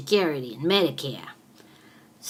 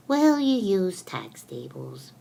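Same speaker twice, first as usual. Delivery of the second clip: normal talking voice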